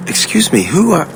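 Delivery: sudden high, surprised interruption; startled. Acted. A prince startled when a stranger mentions his family's killers, high and surprised. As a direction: caught off guard, voice jumping up.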